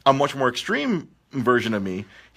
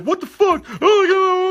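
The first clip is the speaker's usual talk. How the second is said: mock outraged customer; slower than usual. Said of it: "he's like".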